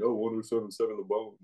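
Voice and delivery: smooth radio announcer voice; a hint of a DJ on air. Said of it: "That was a good radio voice".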